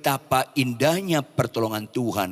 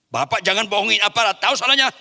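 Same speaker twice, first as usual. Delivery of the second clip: fast, louder accusing cop; stern policeman; 7 semitones higher, 5 dB louder, faster than usual. Retold or imitated. Preacher acts the policeman scolding him, loud and fast. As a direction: press the driver like a stern cop.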